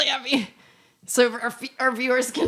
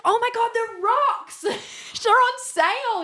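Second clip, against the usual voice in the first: put-on excited girlfriend; 12 semitones higher than usual. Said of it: "She's like".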